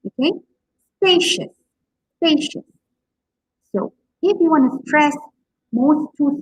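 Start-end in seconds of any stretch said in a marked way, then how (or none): 1.0-2.6 SPA-cious, high on SPA, falling on -cious; spacious with a high, stressed first syllable. Shown as a demonstration. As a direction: jump up on SPA in spacious, then drop. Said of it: "first sound is stress".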